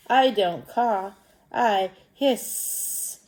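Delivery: slow, with a long hissed final S; snake voice. Acted. Snake answering crow, slow hissing voice. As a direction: stretch out the S in "hiss". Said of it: "by stretching that S out".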